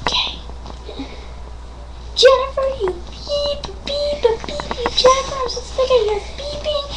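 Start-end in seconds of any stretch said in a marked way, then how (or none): 2.1-6.8 high, soft scolding doll; whiny doll voice. Acted. One doll scolds Jennifer with bleeped words, high soft doll voice.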